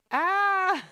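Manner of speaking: high loud yelp; sudden loud shriek; startled yelp. Real, not acted. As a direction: yelp in shock.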